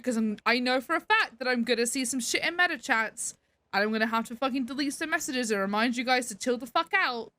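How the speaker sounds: usual speaking voice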